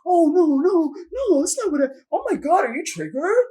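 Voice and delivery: high, loud mocking whine of the ex; mimicking her ex's dismissive backpedaling in a high mocking voice. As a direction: sneer his fake innocence back at him. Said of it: "you're sitting here shitting on me".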